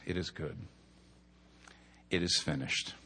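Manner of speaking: low, hushed verdict; solemn quiet pronouncement. Acted. God looks at you and pronounces it, quiet solemnity. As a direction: drop low and soft, with gravity. Voicing God's verdict on work.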